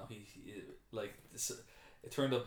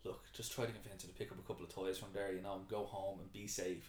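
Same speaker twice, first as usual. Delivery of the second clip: softer acted appeal; reasonable confiding; 2 semitones lower, 7 dB quieter than usual. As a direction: take him aside and ask quietly. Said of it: "he's reasonable with Matt Damon".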